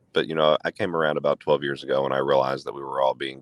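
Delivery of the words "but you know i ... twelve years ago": ordinary delivery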